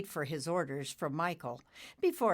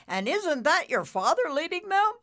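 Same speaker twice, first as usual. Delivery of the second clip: much higher and louder, thrilled; excited question; 12 semitones higher, 8 dB louder than usual. Acted. Bull cloud asks Nimbus about his father, thrilled and loud. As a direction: point out the father in delight.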